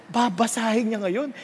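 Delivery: normal talking voice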